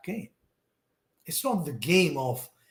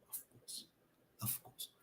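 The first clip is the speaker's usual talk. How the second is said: breathy, hushed repeat; near whisper; 27 dB quieter than usual. Real, not acted. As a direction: say it under your breath, barely voiced. Repeats phrase, first almost whispered.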